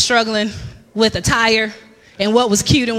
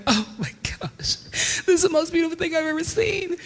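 awestruck; 5 semitones higher, 8 dB quieter than usual. Retold or imitated. First sight of the Magic Kingdom castle, awestruck. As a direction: softly gasp it out as if overwhelmed.

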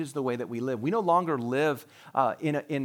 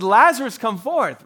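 much louder and higher call; 9 semitones higher, 11 dB louder than usual. Retold or imitated. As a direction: summon Lazarus out of the grave. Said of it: "he called out".

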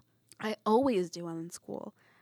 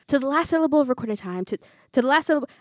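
loud, high, rushed recitation, stumbling; frantic; 5 semitones higher, 9 dB louder, much faster than usual. A narrator's reading. Pupil desperate to remember the Macbeth speech, frantic. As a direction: race through the line in rising panic and break off. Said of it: "I'm frantically trying to remember".

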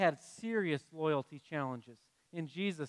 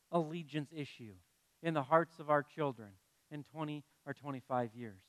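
quieter turn; 5 semitones lower, 6 dB quieter than usual. Real, not acted. Shift toward serious point.

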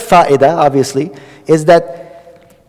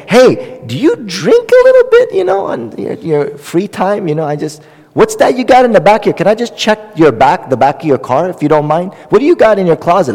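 high, mock-snooping questions, suddenly higher; nosy prying busybody; 4 semitones higher than usual. Halal haram police prying into a brother's car and closet, mock-nosy. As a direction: play the meddling halal police poking around.

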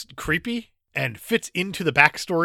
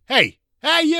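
higher, louder mock hail; raised-voice "hey you" call; 13 semitones higher, 6 dB louder, slower than usual. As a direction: imitate someone calling over a passer-by. Mimicking crew calling to her.

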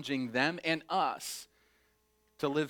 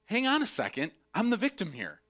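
indignant protest; 7 semitones higher than usual. Retold or imitated. Acting out indignant victim.

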